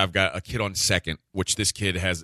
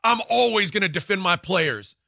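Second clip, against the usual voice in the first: high indignant declaration; the other coach insisting during the yelling match, high; mock indignant coach; 8 semitones higher than usual. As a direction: puff up and defend your players. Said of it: "So we're yelling back and forth".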